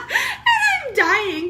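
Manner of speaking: high, slow words squeezed through laughter; laughing it out. Real, not acted. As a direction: still laughing as you talk. Cracking up at friend's joke.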